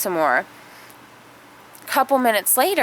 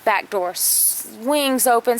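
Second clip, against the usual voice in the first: usual speaking voice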